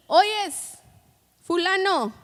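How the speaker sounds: calling out. Acted. God calling out to a listener.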